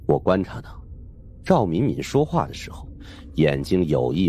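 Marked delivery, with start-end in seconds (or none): none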